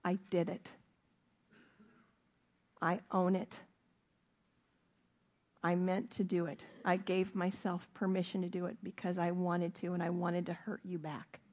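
soft, earnest confession; low, humble true apology; sincere apology. Shown as a demonstration. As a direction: own it quietly, no excuses. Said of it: "a true apology says".

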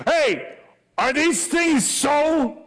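high, booming demand, drawn out; hammed-up, high-pitched shout; loud challenge. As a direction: call out like a judge confronting the accused. Voicing head of Sanhedrin in story.

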